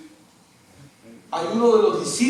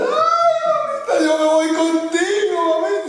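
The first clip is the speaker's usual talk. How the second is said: higher imitation of the daughter's wail; mock grieving wail; 12 semitones higher than usual. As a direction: cry out to the dead mother, hammed up. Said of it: "Gritaba la muchacha". (imitación de la hija gritando a su mamá)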